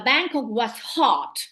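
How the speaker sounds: slow "Bangkok was hot" with weight on "Bangkok"; exclamative tone. Shown as a demonstration. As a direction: draw it out like an exclamation. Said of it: "you can say Bangkok".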